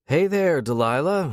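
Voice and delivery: lilting, high sing-song greeting. A narrator's reading. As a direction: croon the name playfully. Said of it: "he says in a sing-song voice".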